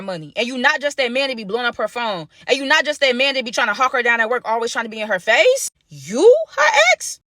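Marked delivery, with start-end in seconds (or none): 5.9-7.2 very high, slow squeal; high drawn-out disbelieving squeal; shocked. Real, not acted. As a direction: stretch it out in disbelief. Shocked realization.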